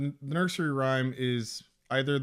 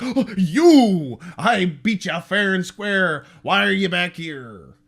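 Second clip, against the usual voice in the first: mock angry confrontation; 3 semitones higher, 8 dB louder, slower than usual. Husband confronting returning leprechaun.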